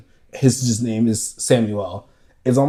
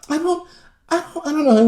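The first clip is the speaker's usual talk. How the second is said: mock scared wife; faster than usual. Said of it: "And she's like".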